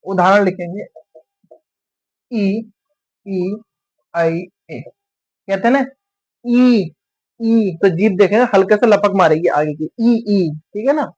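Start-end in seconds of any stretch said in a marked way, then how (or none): 2.3-10.5 drawn-out ई sounds, each said on its own; teacher sounding out ई for the class; vowel ई demo. Shown as a demonstration. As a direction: say ई and show how the tongue moves forward. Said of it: "कोई शब्द बोलूंगा अभी बताता हूँ कैसे".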